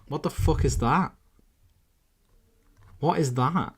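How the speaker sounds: high, loud incredulous question; baffled. Real, not acted. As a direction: ask it in rising disbelief. Baffled at odd thing on screen.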